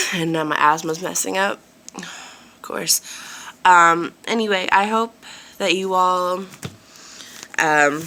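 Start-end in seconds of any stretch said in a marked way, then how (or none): 2.0-3.0 whisper. Real, not acted. Asthma acting up, aside.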